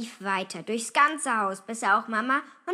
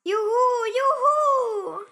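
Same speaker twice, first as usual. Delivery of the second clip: jubilant whoop; 13 semitones higher, 9 dB louder than usual. A narrator's reading. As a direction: whoop with joy. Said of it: "Luisa jauchzte".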